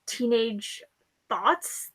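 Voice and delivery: ordinary talk, nothing marked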